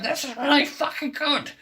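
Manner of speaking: very high put-on cartoon wife voice; Marge Simpson. Marge after taking a sip, high cartoon voice. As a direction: squeal it as a cartoon housewife. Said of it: "she takes a sip and like".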